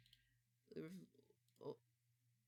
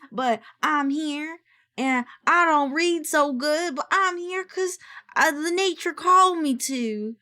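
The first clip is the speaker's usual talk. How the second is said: lightly voiced country bumpkin introducing himself; country accent; 2 semitones higher, 9 dB louder than usual. Streamer introducing her D and D character Pato in a light country accent. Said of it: "he's gonna have a country accent".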